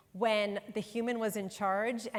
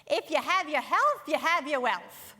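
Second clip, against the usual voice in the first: put-on grandmother's Bronx accent, higher and louder; booming Bronx accent; 6 semitones higher, 4 dB louder than usual. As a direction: boom it out in a thick Bronx accent like an old New York grandma. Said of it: "in her booming Bronx accent".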